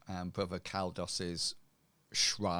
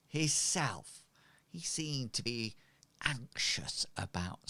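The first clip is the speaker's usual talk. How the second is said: gossiping street woman; 4 semitones higher than usual. Acted. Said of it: "And she says".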